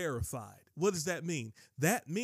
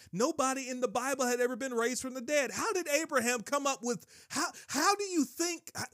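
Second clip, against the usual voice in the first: high, loud-edged amazement; worked-up, disbelieving questioning; incredulous preaching; 8 semitones higher, 3 dB louder than usual. Real, not acted. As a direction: marvel in a high, pressing voice. Preacher marvels at Abraham's faith.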